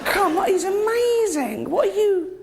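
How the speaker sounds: high, fervent mock preaching; mock evangelist. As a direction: preach it like a giddy convert. Mock preaching God's greatness.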